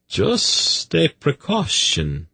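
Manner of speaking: high, slow apology; apologetic. A narrator's reading. Zadi setting the bottle down, apologetic. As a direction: excuse it meekly, pitched high. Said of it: "he apologized".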